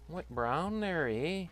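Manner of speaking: playful Canadian accent, drawn out. Real, not acted. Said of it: "my Canadian accent popping through".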